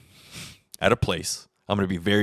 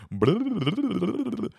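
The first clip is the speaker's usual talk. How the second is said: mocking blah; 13 semitones higher than usual. Mocking someone who talks nonstop.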